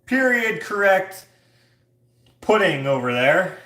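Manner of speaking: loud, high fairground announcement; jester crier. Acted. Jester announcing food at a Renaissance fair, loud proclamation. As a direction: hawk it slowly like a town crier. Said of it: "I always think of a jester".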